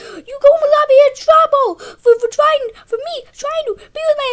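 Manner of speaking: high, loud sobbing whine; mock crying wail. Boy mimics Jack crying as the principal comes, high and loud. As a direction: wail it through fake tears. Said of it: "and he starts crying".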